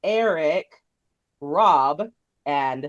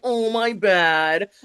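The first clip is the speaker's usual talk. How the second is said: very slow, drawn-out mock contrition; mock apology; 3 semitones higher, 4 dB louder, much slower than usual. Host mocking his excuse to co-hosts, drawling mock apology. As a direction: drag every word out, imitating his excuse. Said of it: "he was pulling the, like".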